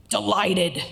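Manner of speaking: forceful angry demo. Shown as a demonstration. Lecturer demonstrates a happy word in an angry voice, angry. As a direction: spit the happy word out in anger. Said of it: "or in an angry tone of voice".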